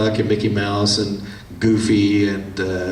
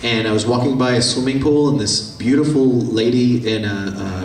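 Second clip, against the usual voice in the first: ordinary talk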